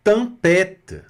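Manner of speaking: Tempête said high with a wide open ê; French tempête. Shown as a demonstration. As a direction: open ê in Tempête, nasal tem. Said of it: "pronúncia bem aberta".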